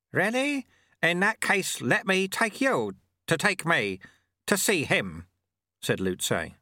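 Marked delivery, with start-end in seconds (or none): 0.1-5.3 put-on breezy, higher-pitched character voice for the old monk; high-pitched old sweeper. A narrator's reading. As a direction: read it out lightly, pitched up.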